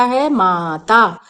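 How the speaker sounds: माता drawn out slowly, both aa sounds long; slow teaching demo. Shown as a demonstration. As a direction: stretch माता for young learners. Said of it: "आ की मात्रा दोनों वर्णों पे लगाते हैं". (माता को बहुत धीरे, लंबे आ के साथ)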